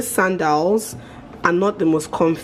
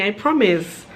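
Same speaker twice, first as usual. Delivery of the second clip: ordinary talk, nothing marked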